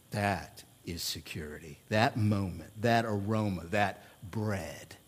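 hushed memory. Real, not acted. Pastor recalling the smell of mom's dinner, hushed. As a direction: savour the moment of coming home. Tender memory of coming home.

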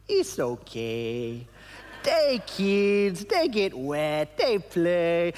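put-on slow, easy Nicaraguan English accent; imitating Cairo's relaxed Nicaraguan English accent. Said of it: "this Nicaraguan English accent".

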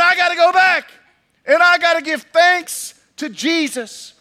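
high, loud eager resolve; urgently determined to go thank Jesus; excited healed man. Acted. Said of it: "And he said".

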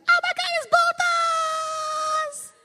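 very high, loud, slow squeal of a mock wife; imitating wife. Husband imitating his wife opening her old boots, high squeal. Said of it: "she's like".

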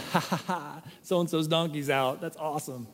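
laughing, mocking gloat over an enemy's trouble; gloating mockery. Preacher voicing the flesh over an enemy's loose donkey, gloating. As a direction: sneer it like the selfish flesh enjoying his loss. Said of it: "that's what your flesh wants to say".

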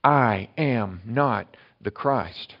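each word laid out very slowly; I am not the Christ, drawn out very slowly; slow and certain. Shown as a demonstration. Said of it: "That's em-, for emphasis. That is for certainty".

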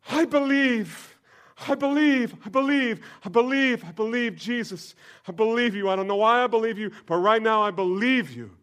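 high, loud, breathless cries of belief; ecstatic newborn believing. Acted. Newborn soul's first breath, shouting belief.

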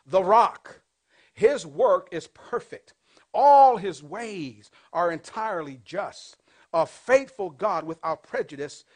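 loud, slow preaching read; booming, slow scripture read. Acted. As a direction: proclaim the verse to the whole church. Reads scripture aloud to congregation.

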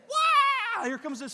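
high, loud yelp of surprise; excited shout. Retold or imitated. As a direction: cry out as the fish flies past. Fish flying past his head.

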